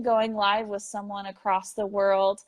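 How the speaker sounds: normal talking voice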